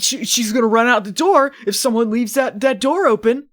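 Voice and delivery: worked-up pet owner worry; mock anxious. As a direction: fret loudly about the door. Acting out worry about the cat.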